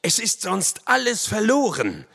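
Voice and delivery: high, urgent shout; urgent cry. A narrator's reading. Narrator to Winnetou during the discovered descent, urgent high cry. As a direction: cry out that time has run out. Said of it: "rief ich".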